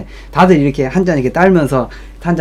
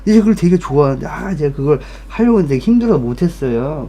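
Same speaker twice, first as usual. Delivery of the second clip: tipsy oversharing rush; overexcited drunk chatter; 6 semitones higher than usual. Retold or imitated. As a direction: gush about his childhood too eagerly. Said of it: "이렇게 시작해".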